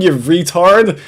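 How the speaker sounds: ordinary delivery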